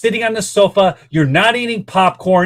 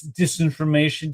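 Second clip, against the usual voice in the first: normal talking voice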